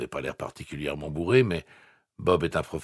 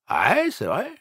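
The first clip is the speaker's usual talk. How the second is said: slurred voice; 13 semitones higher, 8 dB louder than usual. A narrator's reading. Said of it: "Voix pâteuse".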